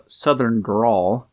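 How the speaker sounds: very slow Southern drawl on Southern drawl; a slow Southern drawl demo. Shown as a demonstration. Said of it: "I can do a halfway decent".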